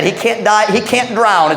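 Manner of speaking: ordinary delivery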